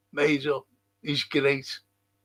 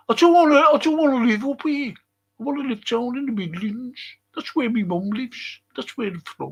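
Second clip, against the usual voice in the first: higher-pitched put-on Brummie accent; whine-free mock Brummie, pitched up; Stan Collymore; 8 semitones higher than usual. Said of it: "Like Stan Collymore".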